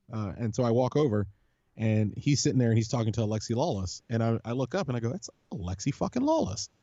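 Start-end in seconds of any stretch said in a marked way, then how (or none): 5.1-6.7 high-pitched, hushed awe; mild starstruck amazement, retold. Fan spotting a soccer idol up close, starstruck.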